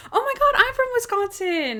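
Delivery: mock excited squeal. Radio producer finds a fellow Wisconsinite, mock thrilled, high-pitched.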